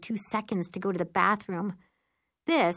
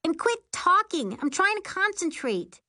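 irritated shout; 12 semitones higher than usual. A narrator's reading. Pilot snapping at passenger mid-flight.

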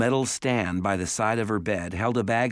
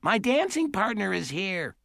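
calling out; 11 semitones higher than usual. A narrator's reading. Wild-haired patient calls from behind a door, calling out high. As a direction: call out in a high character voice. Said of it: "he heard someone call".

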